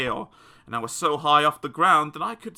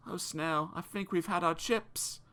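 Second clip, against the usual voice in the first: soft, scared murmur; softer, frightened whisper-like murmur; fearful murmur; 4 semitones higher, 8 dB quieter than usual. Acted. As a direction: murmur it with trembling fear. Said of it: "I murmured through my quivered lips".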